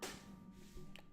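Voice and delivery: whispered relief. Real, not acted. Recovering after the startle, under breath.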